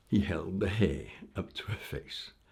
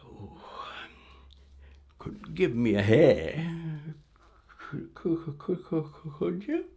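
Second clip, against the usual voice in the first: a light, higher put-on old crone; old lady act; 7 semitones higher than usual. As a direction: stammer it out like a doddering old woman. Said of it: "this old stumbling lady".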